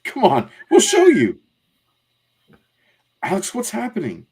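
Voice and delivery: acted excited kid voice; urgent child. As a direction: lead the way, then turn puzzled. Plays children in comic.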